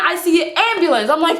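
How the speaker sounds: ordinary delivery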